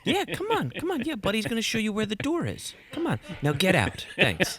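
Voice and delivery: imitating Buddy throwing a guy out, saccharine; mock-cheery Buddy. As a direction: usher him out with a fake smile. Buddy ejecting someone, mock cheerful.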